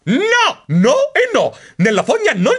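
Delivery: high, loud, emphatic refusal; protesting. A narrator's reading. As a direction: dig in and refuse with outrage. (protesta acuta e indignata)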